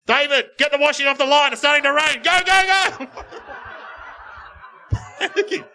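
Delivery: loud, high call over the speaker; urgent call down the house PA; wife calling out. Retold or imitated. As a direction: shout the chores through the monitor. Wife calling over baby monitor.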